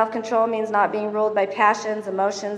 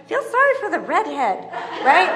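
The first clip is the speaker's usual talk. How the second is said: laughing joke; 6 semitones higher than usual. Retold or imitated. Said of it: "I'm like".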